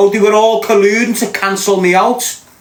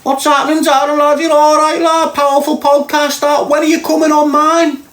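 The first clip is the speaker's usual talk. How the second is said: high-pitched mocking voice of the caller; Marvin; 11 semitones higher than usual. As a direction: send him up as a squeaky little wind-up merchant. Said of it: "I've got this little beaut on the phone".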